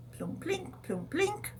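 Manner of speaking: high, soft plinking sounds; ukulele plinks. Retold or imitated. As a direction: pluck imaginary strings with your voice. Imitating ukulele plucking.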